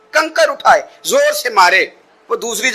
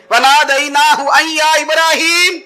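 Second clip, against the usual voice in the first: high, slow chanted call; slow chanted recitation; Quranic recitation; 9 semitones higher, 3 dB louder, slower than usual. Acted. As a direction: draw the verse out like a divine call. Recites verse in drawn-out call.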